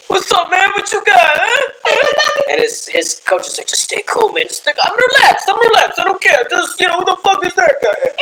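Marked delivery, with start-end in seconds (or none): 0.1-2.5 high-pitched mock Brazilian heckler; Brazilian impression. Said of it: "That's my Brazilian impression". 3.7-8.1 very high-pitched mock Brazilian accent; Brazilian impression. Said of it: "That's my Brazilian impression".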